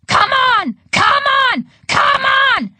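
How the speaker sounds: high, loud cheering on; urgent shout. A narrator's reading. Grandma pounding the pinball machine, urgent high shout. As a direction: yell it at the machine, frantic.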